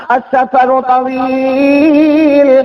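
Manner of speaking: very slow, drawn-out tender chant; father reciting to his daughter with great tenderness; tender recitation. Acted. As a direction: intone each Arabic phrase lovingly. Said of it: "اتنے پیار سے کہا".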